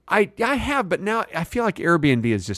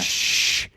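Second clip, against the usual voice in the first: whispered shush; 3 dB quieter than usual. Retold or imitated. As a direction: shush them quietly. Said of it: "She goes".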